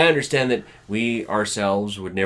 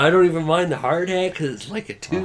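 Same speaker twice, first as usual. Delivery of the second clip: fast, high, loud gushing; hammed-up relief; mock gratitude; 8 semitones higher, 4 dB louder, faster than usual. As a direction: rattle it off as your grateful shivering self. Continues imitation of bundled-up self.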